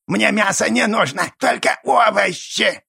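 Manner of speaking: creaky goblin voice. A narrator's reading. Goblin character speaks.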